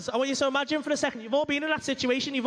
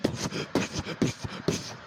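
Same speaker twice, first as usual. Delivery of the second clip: puffed breathy poof sounds; mock spray noise; unvoiced spurting noises; much slower than usual. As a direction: mimic squirting with puffs. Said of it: "and she's like".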